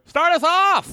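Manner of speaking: sudden high squeak; high squeaky voice. Pretend. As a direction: pop up into a silly high voice. Jokey host voice.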